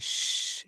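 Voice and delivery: sustained 'shhh'; shushing SH. Shown as a demonstration. Teacher likening SH to quieting children, shushing. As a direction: hush as to children. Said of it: "जैसे बच्चों को हम चुप करवाते हैं".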